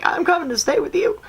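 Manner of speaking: high, eerie spirit voice; spooky ghost voice. Retold or imitated. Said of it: "being like".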